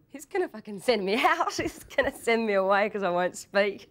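high, loud retold panic; mock-panicked inner voice; nervous panic. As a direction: blurt out the panicked thought, half laughing. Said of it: "shaking with nerves thinking".

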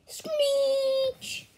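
high, drawn-out screech; mock screech. Voicing a screech in the story, high mock screech. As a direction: screech it out like a sound effect.

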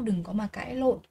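ordinary talk

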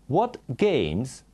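usual speaking voice

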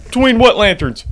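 loud, high exasperated yell; exasperated player shouting at a riddle; frustrated shout. Real, not acted. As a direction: yell at the cryptic clue. Frustrated at clue.

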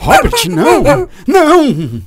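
panicked call. Acted. Calling Robert back, high and panicked.

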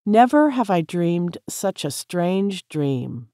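ordinary delivery

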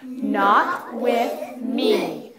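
very slow, drawn-out mean delivery; slow, menacing storybook bully. Shown as a demonstration. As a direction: lean hard on each word, meanly. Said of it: "Do it in a mean voice".

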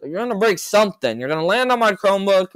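loud, high, exasperated telling off; scolding yell. Real, not acted. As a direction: shout at a little brother to stop. Scolding brother jumping near laptop.

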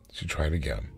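ordinary delivery

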